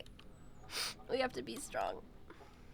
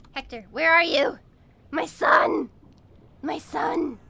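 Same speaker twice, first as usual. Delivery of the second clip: loud, hammed-up shout for a lost child; frantic parent calling; 13 dB louder than usual. Streamer hunting for pet son Hector, mock-frantic shout. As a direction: call out loudly, punching each cry.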